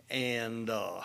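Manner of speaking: ordinary talk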